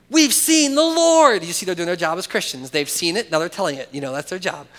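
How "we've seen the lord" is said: much higher and louder, slow and proclaiming; excited announcement. Retold or imitated. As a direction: shout the good news to a friend. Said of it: "the other disciples told him".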